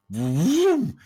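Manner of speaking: loud whooshing vroom; wind whoosh. Retold or imitated. Describing the wind at the peak, loud whoosh. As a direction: sound out the gust loudly.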